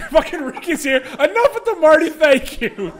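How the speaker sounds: loud, high, laughing scolding; playful fed-up outburst; mock exasperation. Real, not acted. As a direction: scold them loudly, half laughing. Mock-exasperated at people clowning around.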